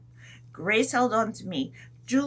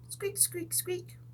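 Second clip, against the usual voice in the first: very high, soft squeaks; chipmunk squeaks; 10 semitones higher, 11 dB quieter, slower than usual. Pretend.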